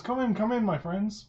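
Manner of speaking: eager welcome. Acted. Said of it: "He says".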